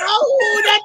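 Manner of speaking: very high, loud frightened cry; panicked shout. Retold or imitated. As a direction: scream it in panic. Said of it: "everybody here say".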